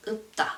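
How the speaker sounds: 없다 as 업따, closed on a B stop; 없다 with only a B-like final; final sounds like B. Shown as a demonstration. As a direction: stop the first syllable of 없다 on B. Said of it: "it sounds like B".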